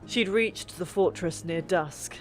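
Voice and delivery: ordinary delivery, nothing marked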